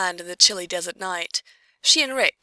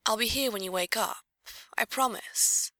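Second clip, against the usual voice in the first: gentle, quiet reassurance; soothing quiet voice; 3 semitones higher, 6 dB quieter than usual. Acted. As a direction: soothe her like a frightened child. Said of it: "same slow, quiet voice she'd use if Alex had a nightmare".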